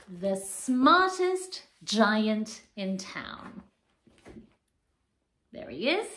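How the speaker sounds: lively storybook reading; reading to children. A narrator's reading. Teacher reading picture book to children, animated. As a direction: bring the pages alive for little ones.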